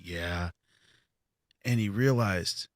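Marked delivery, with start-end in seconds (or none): 0.0-0.5 low, very quiet, downcast reply; imitating a crushed, barely audible answer; dejected. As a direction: mumble it like a beaten fighter, head down. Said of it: "why he was so upset".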